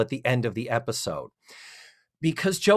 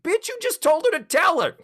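much higher, louder, laughing disbelief; exasperated; 4 dB louder than usual. Retold or imitated. Exasperated at illogical computer.

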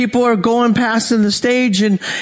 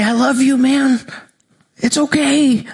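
comforting; 4 semitones higher than usual. Retold or imitated. Parent comforting a crying child, higher tender reassurance. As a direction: soothe a sobbing child gently.

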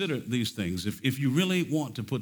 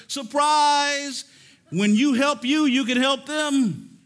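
loud, high, bright voice of God springing a surprise; booming divine surprise, slow at the end; God exclaiming; 9 dB louder, much slower than usual. Pretend. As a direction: announce it like God's own reveal. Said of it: "and God says".

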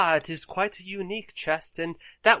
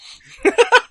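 squealing burst of laughter; a sudden high cackle; 14 dB louder than usual. Real, not acted. GM laughs mid-role.

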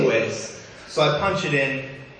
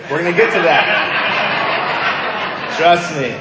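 louder, teasing reassurance; lightly raised, good-humoured rebuke; playful firm reply; 4 semitones higher, 7 dB louder than usual. Real, not acted. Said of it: "You're jumping ahead there".

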